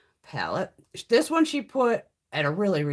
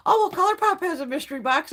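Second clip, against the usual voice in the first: much higher, louder mock-chirpy friend; imitating a friend's cheerful tip, pitched way up; mimicking Gina; 12 semitones higher, 7 dB louder than usual. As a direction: parrot the friend in a bright, high mocking voice. Mimicking Gina's reply.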